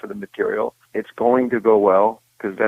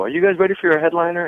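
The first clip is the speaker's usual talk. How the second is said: announcer; 8 semitones higher, faster than usual. Retold or imitated. Backstage announcer calling comics out.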